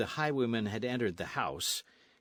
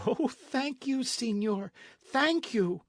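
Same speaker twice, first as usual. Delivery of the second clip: put-on much higher, eager girlish voice; grateful young lady; slower than usual. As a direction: gush thanks to her rescuer. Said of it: "she cried".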